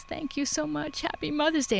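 high, soft, choked-up thank you; retold tearful thanks. Mother thanking the stranger who stayed, high and tearful. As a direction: echo the grateful mom's tearful voice. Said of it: "she starts crying".

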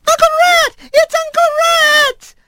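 put-on very high mouse-child squeal; tiny, excited squeaks, last word stretched; squeaking voices. A narrator's reading. Little mice spotting their uncle, squeaking. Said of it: "squeaking voices suddenly rang out".

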